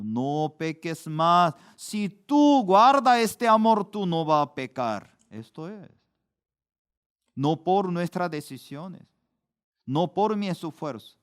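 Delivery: ordinary talk, nothing marked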